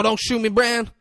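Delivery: high, panicked fake American accent; pleading in a put-on American voice. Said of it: "hits him with a fake accent".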